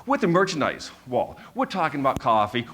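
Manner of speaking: ordinary delivery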